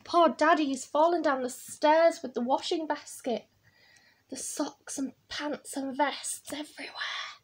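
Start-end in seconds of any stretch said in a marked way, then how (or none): 4.3-7.4 soft hushed whisper. A narrator's reading. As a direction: breathe it out secretly. Hushed aside.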